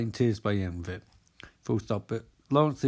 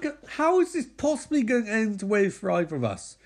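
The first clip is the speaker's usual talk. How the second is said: pleading Katie; 8 semitones higher than usual. Retold or imitated.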